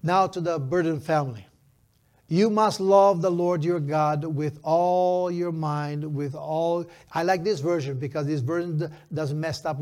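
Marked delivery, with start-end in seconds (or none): 2.3-6.8 slow, stretched-out verse reading; scripture declamation. Acted. Pastor reading the law to the congregation, slow declamation. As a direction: proclaim the verse, drawing out key words.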